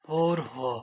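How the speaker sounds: a voiceless hushed clue; secretive whisper. Shown as a demonstration. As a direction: whisper the word. Whispered hint.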